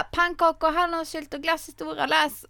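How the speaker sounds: put-on high, coaxing little boy voice; sing-song the treats like bait; boy in the film. Boy tempts Anna with pancakes, high coaxing voice. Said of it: "han bara".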